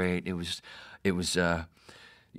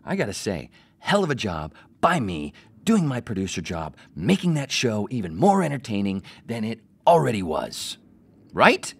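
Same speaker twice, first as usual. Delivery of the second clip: louder, bragging self-praise ending in a high Right; hyped-up retake; 4 semitones higher, 8 dB louder than usual. Shown as a demonstration. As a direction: sell it big this time. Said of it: "Right, take two?".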